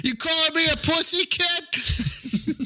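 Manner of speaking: high squeaky Boston tough guy; hammed-up Boston kid; Boston accent. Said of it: "a Boston accent".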